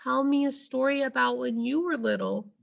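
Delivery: put-on a small child who can't sleep; little elephant voice. A narrator's reading. Ruby asks Ivan for a story, high and small.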